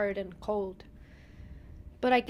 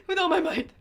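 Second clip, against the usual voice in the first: laughing through the words; giggling while flexing; 7 semitones higher, 3 dB louder than usual. Real, not acted.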